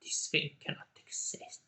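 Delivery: breathless hushed whisper; a voiceless whisper of disbelief; awed whisper. Retold or imitated. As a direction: breathe it out in awe, no voice. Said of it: "Victor is like".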